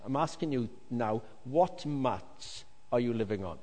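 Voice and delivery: ordinary talk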